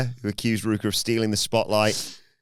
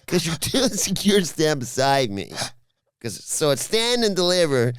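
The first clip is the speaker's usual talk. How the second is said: Californian bro voice; 7 semitones higher than usual. Retold or imitated. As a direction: play Sol as a whiny Californian bro. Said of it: "You do that in Californian?".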